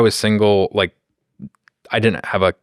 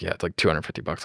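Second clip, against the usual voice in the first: ordinary delivery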